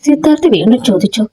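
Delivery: plain reading voice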